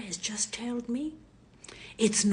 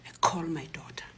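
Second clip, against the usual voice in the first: usual speaking voice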